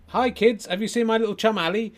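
put-on high, loud children's entertainer patter; ventriloquist act. Ventriloquist asks the kids about his chum, high stage patter. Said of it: "here's my act".

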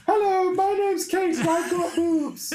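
very high, loud falsetto lady; man doing a woman's voice. Acted. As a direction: shriek it like a hammy lady. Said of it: "That's how you can tell it's a lady".

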